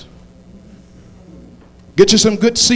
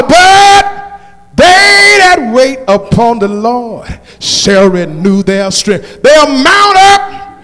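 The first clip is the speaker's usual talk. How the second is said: preaching at full force; 6 dB louder, slower than usual. Acted. Preacher rallying the congregation, full-force whooping sermon.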